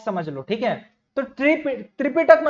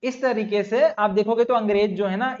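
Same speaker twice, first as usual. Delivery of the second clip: ordinary talk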